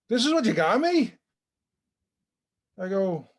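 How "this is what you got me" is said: put-on high, loud childish complaint; disappointed kid. Boy in bed complains to Santa about his gift, high and loud. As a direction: whine it like a let-down little boy.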